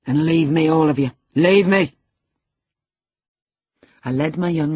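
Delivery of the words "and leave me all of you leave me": loud, rising command; agitated loud order to go; angry dismissal. A narrator's reading. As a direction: drive them all out with raised voice. Heathcliff dismisses everyone in anger.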